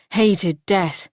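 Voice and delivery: plain reading voice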